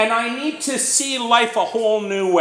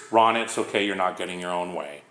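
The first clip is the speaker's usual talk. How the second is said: calm sponsor; 7 dB quieter than usual. Retold or imitated. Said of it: "what he told me".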